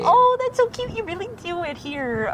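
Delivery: high cooing mock voice; mock cute voice. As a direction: coo it like a doting Midwesterner. Mocking cute Midwestern speech.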